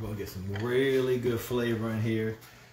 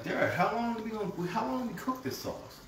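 high mock viewer voice; 11 semitones higher than usual. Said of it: "a lot of people are like".

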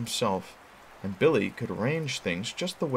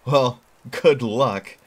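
loud, slow good wishes; 4 semitones higher, 9 dB louder, much slower than usual. Acted. Boy voiced in story.

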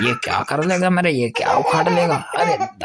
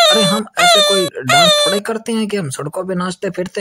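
indignant high whine; 7 semitones higher, 4 dB louder than usual. Acted. Girl indignant, refuses to dance.

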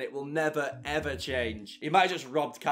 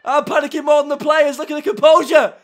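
rising from loud to shouting, much higher throughout; building commentary; 10 semitones higher, 14 dB louder than usual. Real, not acted. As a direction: call the attack as tension climbs to the goal. Live build-up to last-minute goal.

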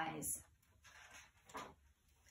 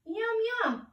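Moth voice; 11 semitones higher than usual. Acted.

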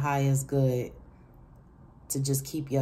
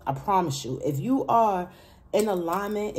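normal talking voice

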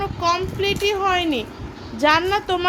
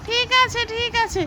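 high doll voice; 6 semitones higher than usual. Pretend. Toy friend agrees.

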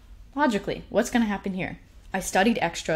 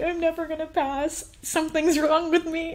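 higher, whiny mock sob of despair; imitating crying; 10 semitones higher than usual. Crying in the car after failing, mock whimper. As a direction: replay her teary despair, whimpering high. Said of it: "crying and being like".

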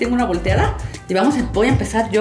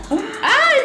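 surprised yelp; 13 semitones higher than usual. Real, not acted. Loses rock paper scissors.

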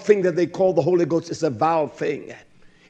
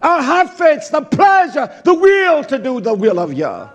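high, loud, impassioned sermon cry; fiery preaching; 7 semitones higher, 6 dB louder than usual. Acted. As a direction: preach it out to the congregation. Preaching at full force.

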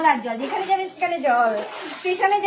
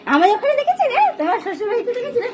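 high excited laughing words; 7 semitones higher, 4 dB louder than usual. Real, not acted. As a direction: laugh through it, thrilled. Excited about fish in flood.

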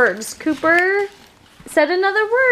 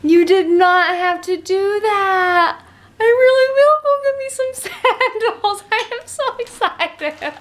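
high, emotional gushing thanks; overwhelmed with joy, voice catching; thrilled, near tears; 7 semitones higher than usual. Real, not acted. Mom thanking friends for gift, gushing and teary.

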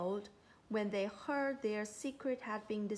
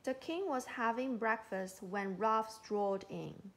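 usual speaking voice

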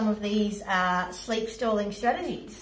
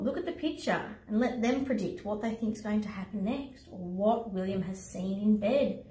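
ordinary delivery, nothing marked

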